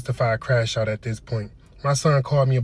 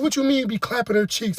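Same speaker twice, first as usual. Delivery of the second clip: shocked question; 8 semitones higher than usual. Retold or imitated. Son's shocked question retold.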